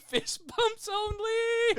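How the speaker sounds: high, slow squeal through laughter; helpless laughter. Real, not acted. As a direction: squeak it out while cracking up. Cracking up over the running joke.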